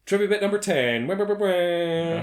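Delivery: loud, fast announcer with a wah jingle. Acted. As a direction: rattle off a quiz jingle.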